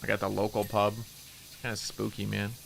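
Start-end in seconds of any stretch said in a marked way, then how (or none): 1.0-2.5 hushed spooky aside. Real, not acted.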